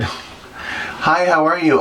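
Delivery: high, breathy little fan hello; chirpy fan greeting. Retold or imitated. A fan greeting the queen in the tanning bed, high and chirpy. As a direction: mimic a stranger popping up to say hi.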